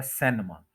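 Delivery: सिनेमा as "SEN-ma"; common mispronunciation. Shown as a demonstration. Teacher showing how it's often said, mispronounced. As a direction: open the first vowel of सिनेमा to "e". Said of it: "बहुत ज़्यादा इस तरह से बोला जाता है".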